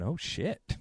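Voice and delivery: softly muttered oh-shit moment; hushed, impressed reaction to oneself; awed aside. Retold or imitated. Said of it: "sitting in the audience going".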